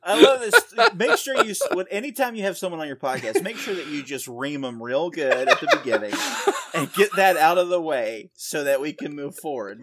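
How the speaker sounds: high, loud laughing mock scold. Real, not acted. Guest mock-scolding the host for teasing, laughing loudly.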